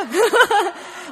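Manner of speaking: loud high laugh; burst of laughter. Real, not acted. Laughing loudly at her husband's answer about the first floor.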